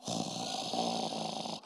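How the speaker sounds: long low whooshing breath; tumbleweed wind noise. Retold or imitated. Comic holding the microphone after a dead joke, imitated tumbleweed whoosh.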